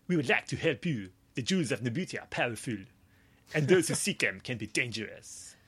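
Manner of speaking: put-on police captain with a French accent. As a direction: read it in a thick French accent. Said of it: "try that accent again".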